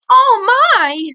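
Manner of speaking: high, loud flustered exclamation; shocked gasp. Acted. Edd realizing why they laugh, flustered. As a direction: cry out in prim embarrassment.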